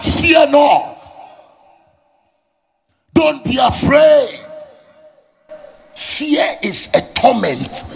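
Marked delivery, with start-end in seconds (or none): 0.0-4.4 proclaiming God's command. Acted. Preacher voicing God's command.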